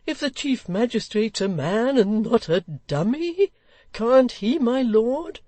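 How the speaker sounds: put-on slow, high, heated old gentleman; choleric. Old gentleman arguing with the Lord Mayor, choleric and slow. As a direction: press the point hotly, word by word. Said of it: "cried the old gentleman in a choleric manner".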